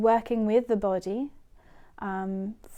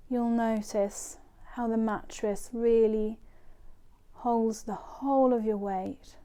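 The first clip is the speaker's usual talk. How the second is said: guided meditation. Acted. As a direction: ease them into relaxation, phrase by phrase. Leads a body scan.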